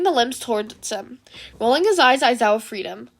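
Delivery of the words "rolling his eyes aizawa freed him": ordinary delivery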